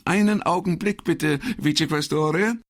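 high woman's voice, tossed aside politely; a quick polite aside away from the phone; high, brisk aside to the boss. Acted. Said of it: "beiseite gesprochenen".

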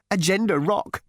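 high, happy little trill. A narrator's reading. Hairdresser correcting the girl, happily trilling. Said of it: "trilled the hairdresser happily".

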